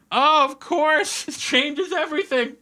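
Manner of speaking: high-pitched, drawn-out sarcastic revelation; mock epiphany. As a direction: gush as if the useless tip solved it all. Mock epiphany at useless advice.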